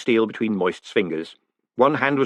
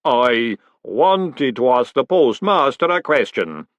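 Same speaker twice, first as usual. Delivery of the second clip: very slow, measured golem voice; golem, slowly; 2 semitones higher, 4 dB louder, much slower than usual. Acted. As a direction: speak slow and weighty like clay. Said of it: "said the golem slowly".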